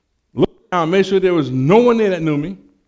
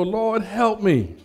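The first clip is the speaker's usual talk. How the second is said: mock desperate prayer; 6 semitones higher, much slower than usual. Retold panicked prayer when caught.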